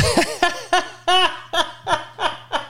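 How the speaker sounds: high, helpless laughing fit; real laugh. Real, not acted. Comedian cracks up at his own parent voice, real laugh. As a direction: break up laughing at your own bit. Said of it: "That literally made me laugh for real".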